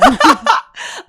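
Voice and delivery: squealing laugh; high burst of laughter. Real, not acted. Laughing at her own tears, high squeal.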